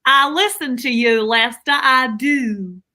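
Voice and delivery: drawling practice Southern accent. Shown as a demonstration. Wife assures husband in a practice Southern accent. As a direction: stretch the vowels in a Southern drawl. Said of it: "There it is".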